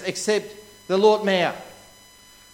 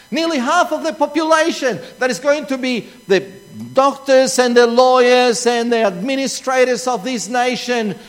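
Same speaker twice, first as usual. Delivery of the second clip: loud, raised preacher's voice; full-force sermon delivery, pitched up; impassioned preaching; 2 semitones higher, 6 dB louder than usual. Real, not acted. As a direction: drive it home loudly to the congregation.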